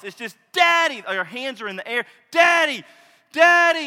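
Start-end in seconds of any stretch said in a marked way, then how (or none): 0.5-1.0 put-on higher and louder, excited children calling Daddy; kids shouting. Preacher voicing his children yelling for their father at the door, high excited shout. 2.3-3.9 put-on higher and louder, excited children calling Daddy; kids shouting. Preacher voicing his children yelling for their father at the door, high excited shout.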